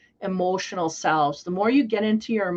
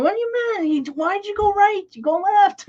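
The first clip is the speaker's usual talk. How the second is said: much higher, louder, exasperated mock outrage; incredulous squeal; 12 semitones higher, 4 dB louder than usual. Other driver after the bumper hit, squealing in disbelief.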